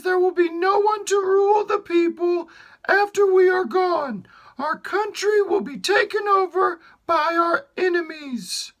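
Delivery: put-on the queen begging for her only child; pleading queen. Queen voiced throughout dialogue.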